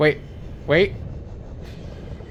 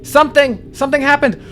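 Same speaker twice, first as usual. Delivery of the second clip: shocked yell; 9 semitones higher than usual. Real, not acted. Player yelling as something hits the sub, shocked yell. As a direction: cry out in sudden shock.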